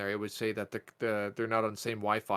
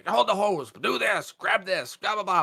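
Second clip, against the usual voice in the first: raised, higher mock orders from the angry sprayer; imitating bossy yelling; 9 semitones higher, 5 dB louder than usual. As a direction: bark the orders back like a stressed coworker. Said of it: "like, they're just".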